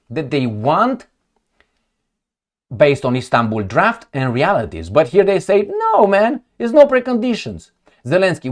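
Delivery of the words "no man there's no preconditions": mock protest. Acting out their casual refusal.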